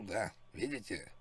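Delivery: ordinary talk, nothing marked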